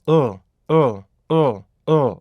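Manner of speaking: 어 as a short voiced L, tongue on the palate; dark L sound. Shown as a demonstration. Teacher models the L sound, short dark L. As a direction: say "어" as a short, falling uhl. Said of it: "이렇게요".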